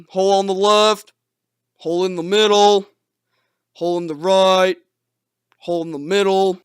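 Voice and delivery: monotonous repeated warning call; droning lookout call; imitated calling out. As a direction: call each hole down the line, evenly spaced. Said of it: "would have to call out the holes".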